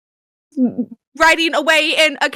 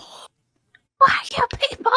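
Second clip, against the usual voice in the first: breathy whispered question, higher; eerie whisper; 9 semitones higher, slower than usual. Pretend. Players echo the children's question in an eerie whisper.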